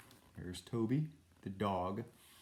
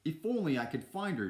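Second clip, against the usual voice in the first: higher-pitched storybook lament; wishful self-talk; 8 semitones higher, 4 dB louder than usual. A narrator's reading. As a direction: wish aloud to oneself. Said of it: "Dawson said to himself".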